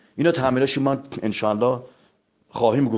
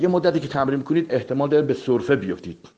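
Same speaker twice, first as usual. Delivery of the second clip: ordinary talk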